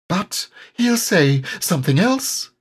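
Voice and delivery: small high timid piglet voice; tiny nervous animal voice; Piglet's small voice. A narrator's reading.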